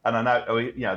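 ordinary talk

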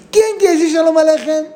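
high, loud happy call; rally the grandkids like a game; excited call. Retold or imitated. Grandmother arriving home, calling the grandchildren, excited. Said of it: "yo llego a la casa así".

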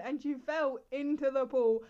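usual speaking voice

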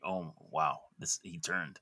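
quiet, low murmur of relief; relieved. Retold or imitated. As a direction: retell a relieved reaction, low and soft. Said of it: "I actually felt relieved".

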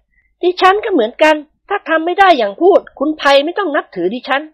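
high, firm woman's voice; a wife set on catching her husband; high-voiced wife, resolute and grim; determined young wife. A narrator's reading. Nuan vows too.

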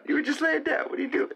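very high-pitched pet talk; scolding the cat. Real, not acted. Asking the cat why it moved, high-pitched. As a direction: pitch right up in mock exasperation.

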